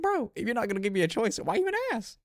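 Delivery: very high-pitched, hammed-up protest; mock exasperated squeal. Retorting to mom who gave no choice, squeaky mock outrage. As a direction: shoot way up into a squeaky, exasperated complaint.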